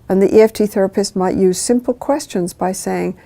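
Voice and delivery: ordinary delivery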